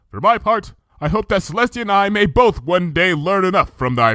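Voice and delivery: measured, archaic royal speech; Luna's regal voice. Acted. Luna recounting the past to Twilight, stately.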